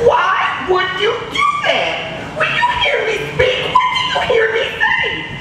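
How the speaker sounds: put-on teacher pleading with a misbehaving boy; exaggerated high plea. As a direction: stretch it up in mock exasperation. Upset teacher asks child.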